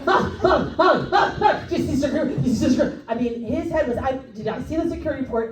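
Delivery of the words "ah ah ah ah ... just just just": frantic, high, stammering bursts; fast panicky sputtering, played for laughs; Jerry. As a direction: imitate a flustered guard who can't get words out. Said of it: "he was talking so fast".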